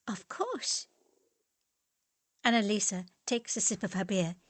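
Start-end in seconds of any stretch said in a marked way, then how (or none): 0.1-0.9 put-on light, high young woman's voice; sweet agreement, high and light; Annalisa's voice. Annalisa to Max, sweetly siding with him.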